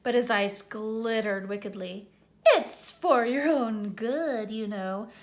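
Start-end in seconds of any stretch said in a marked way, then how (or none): 2.5-5.1 slow, mock-virtuous poltergeist; saintly voice. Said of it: "in a saintly voice".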